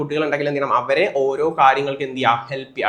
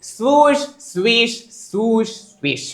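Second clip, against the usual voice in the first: imitated swishing; 8 semitones higher, much slower than usual. Shown as a demonstration. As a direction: mimic skirts hurrying past. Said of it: "അതെന്താ ശബ്ദം?". (സ്വൂഷ് ശബ്ദാനുകരണം)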